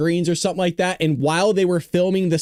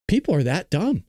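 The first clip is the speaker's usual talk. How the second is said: ordinary talk, nothing marked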